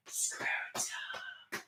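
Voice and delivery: breathy whisper of one word; whispered refrain. Acted. Vlogger's song breaks into a whispered refrain. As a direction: whisper it like a secret.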